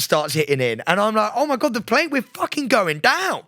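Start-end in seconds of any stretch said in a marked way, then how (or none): 1.3-3.4 high panicked cry, half-acted; retold panic. Retelling his panic on the plane to a friend, high mock panic. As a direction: replay the terror of going down, pitched up. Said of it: "I am so panicked at this point".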